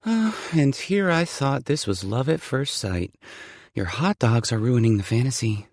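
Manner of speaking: flirty heroine, light and teasing; woman's voice. Acted. Female lead voiced by narrator.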